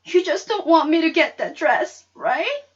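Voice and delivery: put-on high, loud hurt shout of a girl; angry shout. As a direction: yell it at Mama, stung and accusing. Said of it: "and I shouted".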